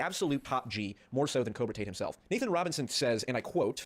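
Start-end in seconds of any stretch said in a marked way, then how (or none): none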